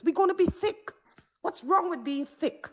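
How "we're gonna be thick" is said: usual speaking voice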